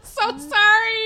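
sorry through a laugh; giggling while apologizing; laughing apology. Real, not acted. Apologizes, laughing, after sad story.

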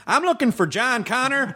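high Southern Terminator; mock audition in high Southern twang; Southern drawl. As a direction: play the killer robot as a high-voiced Southerner. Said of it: "a little bit of the southern".

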